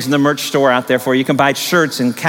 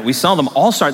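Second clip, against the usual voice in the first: ordinary talk, nothing marked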